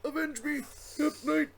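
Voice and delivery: high hammy death cry; overacted death scene. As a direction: cry out and die dramatically. Mock dying cry after story.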